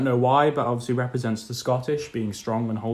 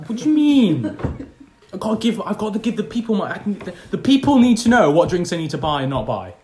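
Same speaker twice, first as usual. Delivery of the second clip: higher, louder mock-indignant; indignant protest; 6 semitones higher, 4 dB louder than usual. Real, not acted. Host defending his drink review to co-host, worked up.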